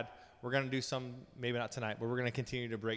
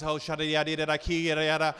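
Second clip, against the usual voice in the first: rapid-fire babbled syllables, louder; mock speaking in tongues; 2 semitones higher, 7 dB louder than usual. Terrified passenger beside a little girl, mock tongues. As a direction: imitate frantic praying in tongues. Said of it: "I'm, like, speaking in tongues".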